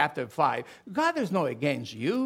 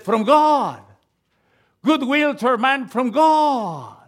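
louder and higher, calling it out to the church; ringing proclamation; 6 semitones higher, 7 dB louder than usual. Acted. Preacher booming the angels' words to the congregation, loud and high. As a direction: lift the voice high and loud on God.

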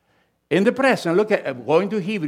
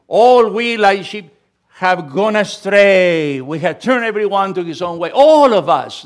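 forceful preaching; 3 semitones higher, 8 dB louder than usual. Real, not acted. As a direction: proclaim it to the whole church. Preacher proclaiming scripture to congregation.

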